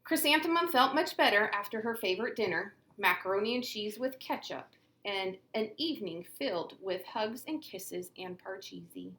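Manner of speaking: usual speaking voice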